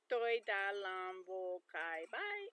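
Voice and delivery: "tôi đã làm vui cái bye" said slowly with the tones stretched out; Vietnamese phrase, slowly. Shown as a demonstration. Learner sounding out a phrase for viewers, slow and careful. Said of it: "And if it's B A I".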